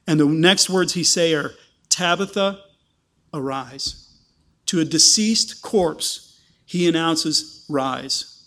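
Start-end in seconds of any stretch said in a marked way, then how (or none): 1.9-4.1 solemn command. Retold or imitated. Peter's command to the dead. 7.7-8.4 low solemn command. Retold or imitated. Peter commands the corpse.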